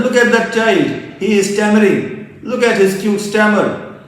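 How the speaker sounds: high, loud taunt; teasing peers. Acted. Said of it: "continuously points out that".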